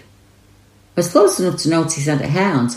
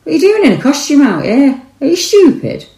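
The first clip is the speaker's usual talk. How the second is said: loud, much higher-pitched call; friendly shout; 12 semitones higher, 5 dB louder than usual. Acted. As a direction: call out loudly to someone far off, keeping it friendly. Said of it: "shouted in a friendly voice".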